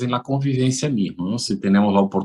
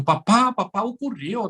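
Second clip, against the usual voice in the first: daughter bursting with news, much higher; excited daughter; 11 semitones higher than usual. Retold or imitated. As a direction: call out papá high and eager. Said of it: "Un día ella llegó y dijo". (hija emocionada, voz mucho más aguda)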